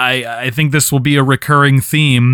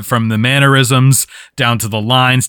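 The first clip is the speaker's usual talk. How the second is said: normal talking voice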